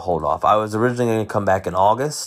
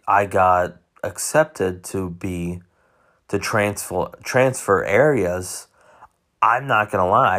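ordinary talk

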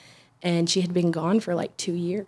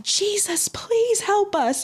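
much higher, slow desperate plea; pleading cry to Jesus; 14 semitones higher, much slower than usual. Retold or imitated. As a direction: cry out to Jesus as the car stalls. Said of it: "What did you just yell?".

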